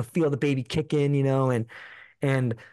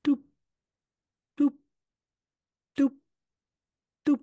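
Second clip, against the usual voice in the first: heartbeat imitation; 13 semitones higher than usual. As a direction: tap out the heartbeat sound with long gaps between each beat.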